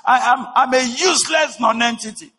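high, loud, anguished cry to his father; prodigal son pleading. Acted. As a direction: plead for forgiveness with a shout. Voicing the prodigal son's confession.

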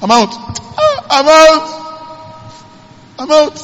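high, far, exultant cry of freedom; joyful shout. Acted. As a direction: sing out the release like a freed prisoner. Joseph rejoicing, freed from prison.

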